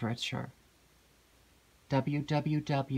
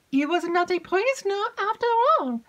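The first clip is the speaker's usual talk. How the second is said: high, loud eager maid's voice; breathless excited maid; 6 dB louder than usual. Acted. Maid rushes in to tell Emily news, excited.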